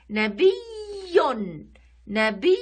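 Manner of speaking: نبي with a loud, held doubled y and nunated -un ending; pronunciation demo. Shown as a demonstration. Showing pupils how نبي is pronounced, loud stretched shadda. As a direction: lean hard and long on the shadda in نبي, ending -yun. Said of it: "كيف ننطقها؟".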